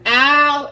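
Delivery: sharp ow from burning finger; pained yelp. Real, not acted. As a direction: yelp at the hot pan. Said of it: "I burnt my finger".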